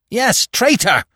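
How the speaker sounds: high, forceful, spat accusation; hissed accusation. A narrator's reading. Fox backing the squirrel's charge, high angry hiss. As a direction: throw it at him with venom. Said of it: "hissed the fox".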